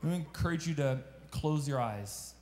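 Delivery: soft, low voice leading a prayer over music. Acted. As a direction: gently invite them to close their eyes and pray.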